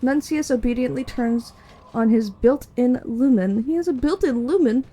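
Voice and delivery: steady, measured scene-setting read; game master narration. Acted.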